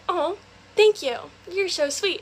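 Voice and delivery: put-on coy sweetness. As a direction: imitate a girl lapping up a compliment.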